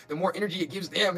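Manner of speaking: normal talking voice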